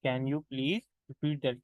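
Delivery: slow, even repeat of the drill; second slow take for students. Shown as a demonstration. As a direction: repeat it slowly and clearly. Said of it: "तो आप उसे कहते हो".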